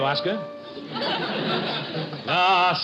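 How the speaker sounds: ordinary talk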